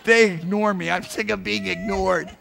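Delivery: hammed-up indignation; mock aggrieved. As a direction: play someone sick of being snubbed. Voicing the ignored Holy Ghost.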